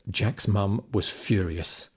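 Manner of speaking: ordinary delivery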